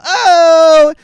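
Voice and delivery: high, loud falsetto "Oh!". Retold or imitated. Imitating [name] jumping on the car, high falsetto yelp. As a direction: hit a high, sharp yelp. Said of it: "he does the".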